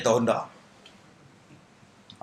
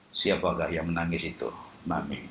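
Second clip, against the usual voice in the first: put-on blind old Kasim; 10 semitones lower, 8 dB quieter than usual. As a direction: drop low to play blind Kasim. Blind Kasim asks his mother. (suara Kasim yang buta, rendah)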